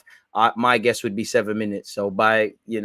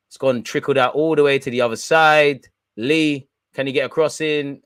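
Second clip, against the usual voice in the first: louder, pacy play-by-play; live commentary; 3 semitones higher, 6 dB louder than usual. Acted. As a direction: call the attack like a commentator.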